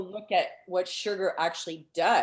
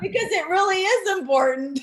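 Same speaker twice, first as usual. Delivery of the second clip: much higher and louder, bubbling over; excited, high; 11 semitones higher, 9 dB louder than usual. Real, not acted. Guest insisting laughter matters, high and excited. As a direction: push it up high with delight. Still laughing after joke.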